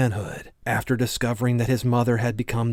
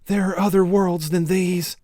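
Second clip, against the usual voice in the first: lightly voiced boy Jake; 8 semitones higher, 4 dB louder than usual. A narrator's reading. Jake's last words before the fall.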